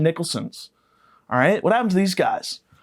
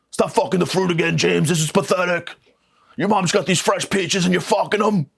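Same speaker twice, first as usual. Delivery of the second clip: dad and son voices; 6 semitones higher than usual. Retold or imitated. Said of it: "Yelled at by my dad".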